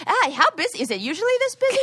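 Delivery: high-pitched overeager small talk; acted chirpy chit-chat, pitch shooting up; nervous chatter. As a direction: gush questions to keep them distracted. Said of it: "talking and talking".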